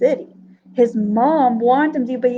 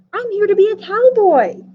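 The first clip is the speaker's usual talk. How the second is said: higher and louder, bursting with eagerness; eager announcement; 7 semitones higher, 4 dB louder than usual. Acted. Little Carr tells cowboy Dusty his dream, eager and loud. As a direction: announce it proudly, all hope.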